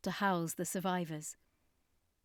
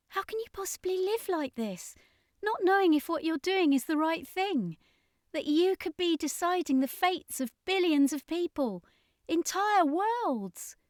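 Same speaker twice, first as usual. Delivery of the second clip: Ashley; 10 semitones higher, 3 dB louder than usual. A narrator's reading. Shocked Ashley, pitch very high.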